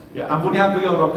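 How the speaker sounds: ordinary delivery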